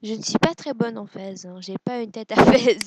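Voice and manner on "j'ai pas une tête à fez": laughing through the line, louder. Real, not acted. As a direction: laugh at yourself in the fez. Joking about her look in a fez.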